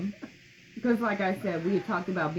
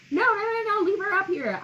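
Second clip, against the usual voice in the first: much higher, louder pleading no, no, no; begging them not to take her away; desperate plea; 12 semitones higher, 3 dB louder than usual. Retold or imitated. Daughter retelling begging staff not to wheel mom away, anguished high plea.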